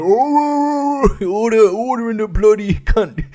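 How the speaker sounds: high, drawn-out jeer turning into mock call for order; rowdy parliament. Comedian imagining the House of Commons in session, mock parliamentary uproar. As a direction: hoot like a crowd of heckling MPs, hammed-up. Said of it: "where everyone's going".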